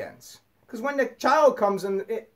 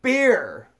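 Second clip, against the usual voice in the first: mock scolding shout; 4 semitones higher than usual. Mimicking people who nag the drinker, a sneering higher shout. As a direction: shout it like a preachy critic. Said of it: "when people would say".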